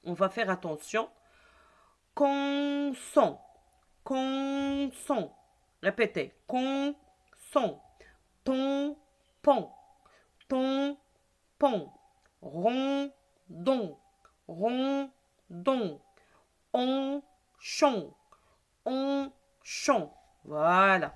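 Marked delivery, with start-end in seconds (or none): 2.1-5.4 "Consent" split in two, first syllable held long and high; decoding slowly. Shown as a demonstration. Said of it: "Là, je décode". 6.5-20.1 first syllable held high, second dropped after a gap; teacher's slow decoding, long level first syllable; decoding slowly. Shown as a demonstration. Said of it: "Là, je décode".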